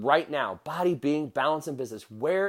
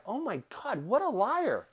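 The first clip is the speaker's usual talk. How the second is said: mock shocked self-scolding; 7 semitones higher, 4 dB quieter than usual. Catching himself lying to wife.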